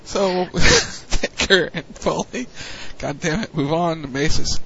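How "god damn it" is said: normal talking voice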